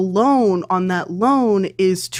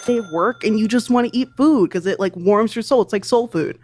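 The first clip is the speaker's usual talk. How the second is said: ordinary delivery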